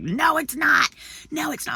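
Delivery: high-pitched petulant denial; mock yelling. Man denies it is in his head, mock yelling. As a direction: imitate him arguing back, not at full volume. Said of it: "when he started yelling".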